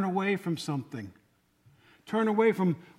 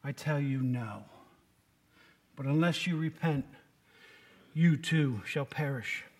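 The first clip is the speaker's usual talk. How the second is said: solemn warning; 6 semitones lower, 5 dB quieter than usual. A narrator's reading. Repeated scripture warning, slower.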